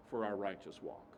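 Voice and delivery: usual speaking voice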